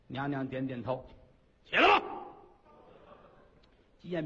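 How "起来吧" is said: booming command, much higher and louder; thunderous queen. Acted. Ugly queen tells the consort to rise, booming. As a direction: boom it out like thunder. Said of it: "打雷呢啊！".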